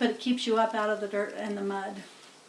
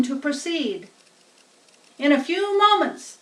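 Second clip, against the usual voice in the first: loud, higher grand PA announcement; pompous fair announcer; 7 semitones higher, 7 dB louder than usual. Acted. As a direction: puff up every phrase like a showman. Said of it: "in a pompous voice".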